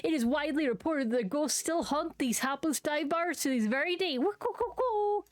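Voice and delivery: campy ghost-tour showman; spooky tour guide. Acted. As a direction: ham up the haunted history with ghostly hoots.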